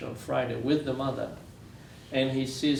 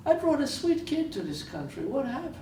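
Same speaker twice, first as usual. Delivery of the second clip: higher, imitating a grieving father's broken English; 13 semitones higher than usual. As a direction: ask it as the broken-hearted father in his broken English, pitched higher. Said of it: "I love his broken English".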